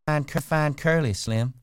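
put-on high, loud coy young woman. A narrator's reading. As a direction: play her sweet and coy. Curley's wife voiced high.

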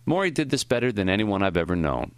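plain reading voice

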